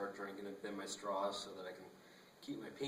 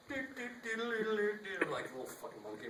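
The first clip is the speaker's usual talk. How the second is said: mock high voice; 11 semitones higher, slower than usual. Real, not acted. Self-deprecating aside, high slow.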